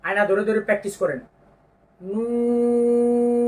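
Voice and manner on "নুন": slow drawn-out নুন, rounded lips; letter name Nun. Shown as a demonstration. Teacher demonstrating নুন with rounded lips. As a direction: stretch নুন, lips round then open. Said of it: "এই যে দেখেন শেষে ফাঁকা হয়ে যাবে".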